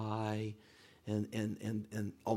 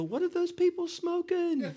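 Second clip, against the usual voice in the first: high, loud, drawn-out mock outrage; steaming dad; 5 dB louder, slower than usual. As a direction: imitate an indignant father over the phone. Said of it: "he's just steaming on the other end".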